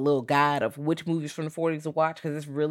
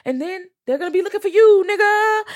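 high, loud wrestler-style callout; Booker T; 9 dB louder than usual. Retold or imitated. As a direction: holler it as an over-the-top impression, pitch way up. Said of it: "went to the Booker T voice".

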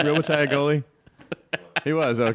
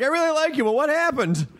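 high-pitched imitated plea; Jonah; 13 semitones higher than usual. Comic mimicking a guest's imagined reaction, high imitation. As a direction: imitate someone in a much higher voice. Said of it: "Jonah's gonna be like".

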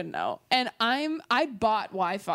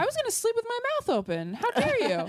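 mock indignant; 13 semitones higher than usual. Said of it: "I'm like".

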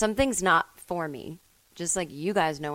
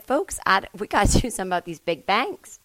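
louder folksy Palin twang; Sarah Palin impression; 5 dB louder than usual. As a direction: do Elizabeth Warren doing Palin. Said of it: "sounds like Sarah Palin".

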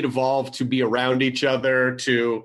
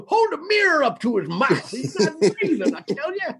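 put-on much higher, laughing old Western coot; hammy frontier codger; old-timer voice; 12 semitones higher than usual. Playing an old-timer at body.

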